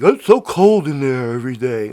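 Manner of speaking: lightly put-on Trump grumble; Trump complaining. Host mocking Trump complaining in court, put-on whine. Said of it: "not like Trump in court".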